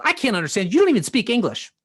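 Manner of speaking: retold dismissive cop. Cop to son with an accent, retold dismissively. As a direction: cut him off, mildly exasperated.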